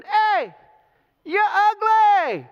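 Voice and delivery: high, loud playground taunt, stretched slowly; taunting soldier yelling a slow insult; mock taunting yell. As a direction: jeer across the valley like a soldier. Armies yelling insults across valley.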